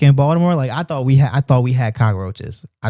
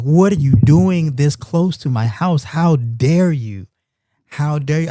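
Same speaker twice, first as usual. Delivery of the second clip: drawn-out, louder, higher outrage; mock indignant anger; 4 semitones higher, 4 dB louder, slower than usual. Streamer retelling how he confronted a cockroach, mock indignant. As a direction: scold the intruder with exaggerated, stretched-out indignation. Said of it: "I got mad".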